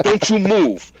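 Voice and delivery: high, loud stern command; mock furious order; retold scolding. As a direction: bark it like an angry sergeant. Sergeant scolding private, retold.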